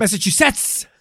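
"Massachusetts" high, with a hard "SETS" ending; exaggerated correction. Shown as a demonstration. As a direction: punch the last syllable of "Massachusetts". Correcting the slurred place name.

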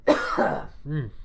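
coughing, choking; choked cough mid-sentence; choking on a drink. Real, not acted. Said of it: "sorry, I'm choking over here".

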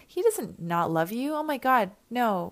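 ordinary delivery

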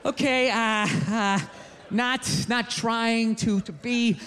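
slow, halting, careful wording; tactful hesitation. Acted. Human gently turns down gorilla's escape plan, slow and hesitant. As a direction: pick each word carefully, with awkward gaps.

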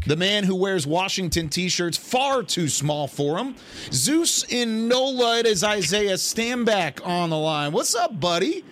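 big playful build-up, high-pitched; mock-grand announcer. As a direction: introduce him like a ring announcer, stretching the name. Mock-grand introduction of caller.

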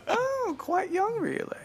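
put-on high, slow prim old-lady voice; Miss Slocum imitation. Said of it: "he was like".

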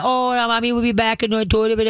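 put-on high, fussy baby talk to the dog; mock doting owner. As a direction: coo at the dog like a doting mommy. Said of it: "you don't do the".

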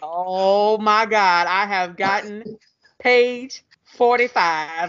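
slow, hammed-up astonishment with dramatic pauses; William Shatner meme. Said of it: "the William Shatner meme".

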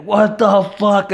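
bewildered what the fuck. Retold or imitated. Retold confusion, everyone staring.

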